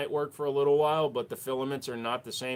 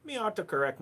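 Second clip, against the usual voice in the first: exaggerated Irish accent; 6 semitones higher than usual. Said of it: "over-exaggeration of the accent".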